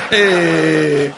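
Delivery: loud, laughing voice of a scolding wife; laughing mock wife. Preacher mimics wife scolding husband, laughing loudly. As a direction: hoot it out with laughter. Mocking wife's reply, laughing.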